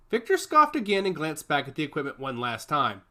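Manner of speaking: ordinary delivery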